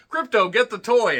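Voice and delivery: high, loud command to a dog; calling the dog. Acted. Superman sends Krypto after the drones, calling out. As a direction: call out excitedly to your dog.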